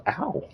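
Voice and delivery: high startled yelp of pain; sudden yelp. Real, not acted. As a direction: yelp at the bite. Cat bites ankle.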